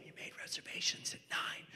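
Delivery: soft conspiratorial whisper; whispered aside. Acted. Comic justifies staying out past nine, whispering.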